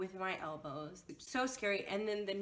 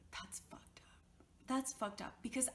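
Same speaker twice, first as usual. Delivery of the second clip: whisper; 5 semitones higher, 5 dB quieter than usual. Real, not acted. Angry aside after the story.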